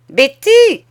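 high, loud, sour call for the servant; cri aigre. A narrator's reading. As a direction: cry out the name sharply, pitched high. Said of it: "cria la cousine d'une voix aigre". (cri aigre et perçant)

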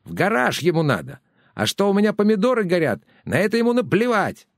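high, fast shrill complaining; shrill nagging grandma. A narrator's reading. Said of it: "закричала тётка". (визгливо причитающая тётка)

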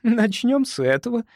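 high sweet coo. Acted. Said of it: "проворковала она".

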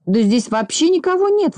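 высокий удивлённый возглас; воскликни с подъёмом, высоко; восклицание. A narrator's reading. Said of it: "воскликнул Незнайка". (изумлённо воскликнуть)

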